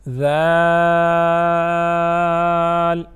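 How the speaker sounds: very slow, loud ذال with a soft consonant. Shown as a demonstration. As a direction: stretch ذال out softly articulated, on one note. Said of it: "ذال کو نرم پڑھیں گے". (نرم اور لمبا ذال)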